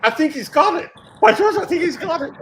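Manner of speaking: high, loud triumphant Higgins; Henry Higgins. Retold or imitated. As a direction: crow it like a delighted professor. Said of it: "quoting My Fair Lady".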